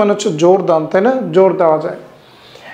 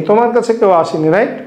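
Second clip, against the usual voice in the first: ordinary delivery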